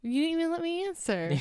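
high, fast, put-out objection; indignant protest. Real, not acted. Co-host objects to being cut off, indignant.

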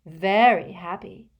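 sniffy. A narrator's reading. Trelawney confirming to Harry what she heard, sniffily. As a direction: answer with sniffy disdain. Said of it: "said Professor Trelawney sniffily".

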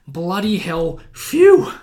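a hammed-up gasp of relief; mock exclamation.

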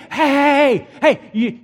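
high, taunting calls of hey; mocking. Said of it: "Elijah began mocking them".

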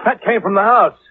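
startled. Acted. Sudden shout at a noise.

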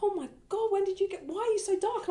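very high-pitched, gushing shock; mock astonishment. People reacting to her summer tan, mock astonishment.

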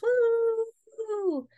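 high, drawn-out "woo"; a long, high-pitched cheer; playful woo. Real, not acted. Podcast host hypes her episode title, playful high woo.

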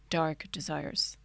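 slow, low seductive whisper; dark sexy voice. Pretend. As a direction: drag it out slow and sultry. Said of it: "I wanna take two".

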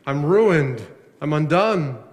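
loud prophet's cry, imitated. Preacher voicing Isaiah before God's glory, crying out. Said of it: "he cries out".

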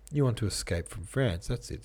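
ordinary delivery, nothing marked

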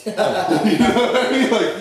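words half lost in real laughter; laughing. Real, not acted. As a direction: laugh through it at the memory. Laughing at his own story.

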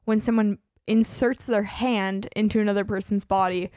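ordinary talk, nothing marked

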